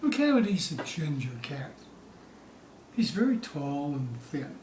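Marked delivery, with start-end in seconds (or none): none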